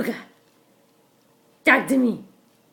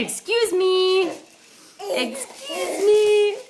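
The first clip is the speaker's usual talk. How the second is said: put-on slow, high modelling for the baby; teaching voice; 7 semitones higher, much slower than usual. Shown as a demonstration.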